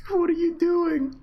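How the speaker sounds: high, drawn-out disbelief; high amazed slow cry; incredulous squeal. Real, not acted. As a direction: shriek the question. Incredulous at player.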